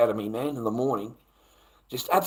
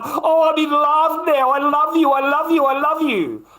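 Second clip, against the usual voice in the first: mocking lovesick boy; 11 semitones higher, 7 dB louder than usual. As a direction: gush like a lovesick teenager. Mocking boys falling in love.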